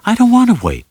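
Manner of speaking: high, loud cry of protest; sudden high plea to Sam; pleading outburst. Acted. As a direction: burst out, desperate not to wait.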